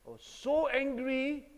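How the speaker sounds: "so" drawn out, high and loud; emphatic, loud retelling of anger; stress on "so". Retold or imitated. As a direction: hit "so" hard, stretching it with high pitch. Emphasising his anger in the story.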